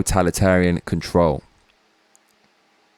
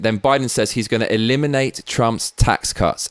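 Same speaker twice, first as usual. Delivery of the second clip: usual speaking voice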